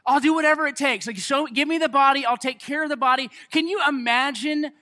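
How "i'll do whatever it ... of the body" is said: urgent resolve. Retold or imitated. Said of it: "you just think".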